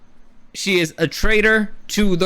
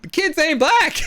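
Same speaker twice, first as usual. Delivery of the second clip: mock Aegon; 10 semitones higher than usual. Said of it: "Aegon basically is like".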